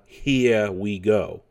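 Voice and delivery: slow New York "Here", r dropped; New York inflection. Shown as a demonstration. Contrasting it with the regular voice, New York inflection.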